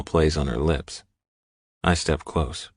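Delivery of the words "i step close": usual speaking voice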